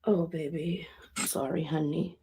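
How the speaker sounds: tender comfort. Real, not acted. Comforting the dead girl.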